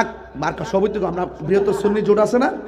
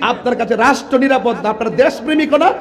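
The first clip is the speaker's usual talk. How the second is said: higher, louder sermon at full force; fiery preaching; 9 semitones higher, 8 dB louder than usual. Acted. Preacher denouncing politicians to the rally, shouting. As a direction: rouse the crowd, building to shouts.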